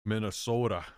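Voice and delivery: put-on halting Minnesota accent turning slightly Austrian; lightly Arnold-tinged Minnesota impression; Minnesota accent sliding into Arnold. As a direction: try a Minnesota politician, slip into Schwarzenegger's accent. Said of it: "I gotta keep slipping into Arnold".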